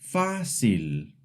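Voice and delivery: FÁcil, first syllable strong; fácil with the weight on fá; stress pulled forward. Shown as a demonstration. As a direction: stress the start of fácil, ease off cil. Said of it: "The accent mark pulls the stress forward".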